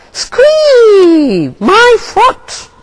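high, loud bird shriek of pain; pained squawk. A narrator's reading. Gull crying out in pain, high shriek. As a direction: Gull yelps as the thorn stabs, high shriek. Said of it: "Gull cried out".

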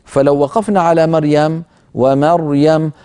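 ordinary talk

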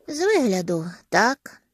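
mildly sleepy answer; a touch of drowsiness. A narrator's reading. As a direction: answer as if half awake. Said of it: "сонно озвався Харрісон".

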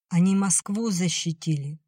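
ordinary delivery, nothing marked

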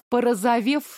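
usual speaking voice

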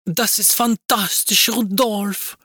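high, slow languid German moan. Acted. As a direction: sigh it out languidly. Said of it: "издавала томные звуки".

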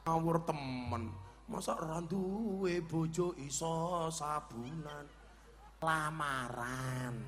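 slow, soft retort. Acted. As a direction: stretch the scolding out gently, like a chant. Same retort redone slow and low. (omelan pelan, rendah dan mendayu)

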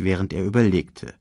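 usual speaking voice

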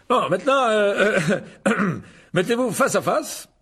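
loud, high, hesitant orders; pompous teacher. A narrator's reading. Lockhart lines up the duellists, loud and hesitating. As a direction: direct the hall, fumbling then projecting.

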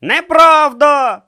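loud, high, drawn-out denial; indignant cry; shouting. Acted. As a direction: shout the denial at full force. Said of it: "крикнув його суперник".

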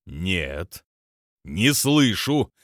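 growling. A narrator's reading. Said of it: "прорычал Лакфро".

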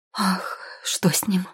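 slow, hushed worried question; tense, breathy alarm; anxious mother. A narrator's reading. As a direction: ask with sudden worry for a child. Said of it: "подобралась женщина".

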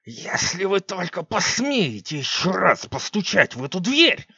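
slow menacing witch hiss. Acted. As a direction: hiss the threat slowly at the guards. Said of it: "прошипела Сикс".

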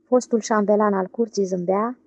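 ordinary delivery

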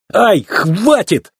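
high, drawn-out yelp; startled cry. A narrator's reading. Racer doused with cold water, startled cry. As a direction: cry out in dismayed protest, stretching it. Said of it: "вскрикнул обескураженный гонщик".

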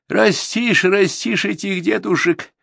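high, slow, bitter mother's lament. Acted. Mother bitterly lamenting to the guest about her daughters. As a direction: mourn how the children turn out. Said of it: "горько проговорила мать".

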